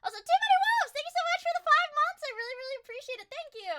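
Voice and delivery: high, fast squeaky thanks; squeaky thank you voice. Pretend. Thanking a subscriber.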